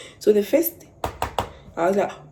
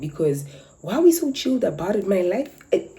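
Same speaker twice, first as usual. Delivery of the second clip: usual speaking voice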